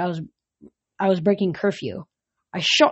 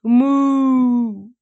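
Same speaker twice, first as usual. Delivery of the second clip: a high, loud mournful moo; sad moo; 5 semitones higher, 5 dB louder than usual. Acted. Tangled calf mooing sadly at the hero. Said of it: "looked at me sadly".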